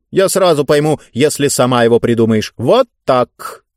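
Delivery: self-important ruler striking a bargain; pompous ruler. Acted. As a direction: spell out the rules imperiously.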